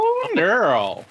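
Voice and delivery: high, drawn-out "Oh, neural"; playful exclamation. Pretend. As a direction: stretch "Oh, neural" into a sassy high swoop. Playful mispronounced tech word.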